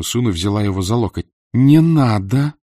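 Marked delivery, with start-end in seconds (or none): 1.4-2.5 higher, louder, desperate plea; louder pleading cry. Acted. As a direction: cry out to stop her son. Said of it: "Луиза Грей сказала это".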